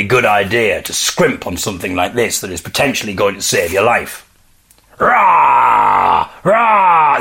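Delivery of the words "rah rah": hammed-up bear roar; playful bear roar. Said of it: "This is me pretending to be a bear now".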